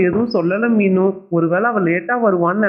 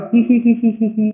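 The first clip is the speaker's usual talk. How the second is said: high, teasing hee-hee cackle; a bratty hee-hee giggle; mocking laugh; 3 semitones higher than usual. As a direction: snicker at her like a bully. Teasing a classmate's sack bag.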